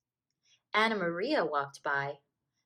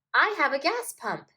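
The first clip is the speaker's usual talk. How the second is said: put-on high, loud eager boy; eager child; 7 semitones higher, 6 dB louder than usual. A narrator's reading. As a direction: share it eagerly. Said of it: "said Jim".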